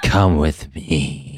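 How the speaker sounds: slow, low spooky haunted house voice; Caliban voice. Pretend. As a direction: creep out each name slowly, like a haunted house host. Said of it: "tapping into some haunted house voice right there".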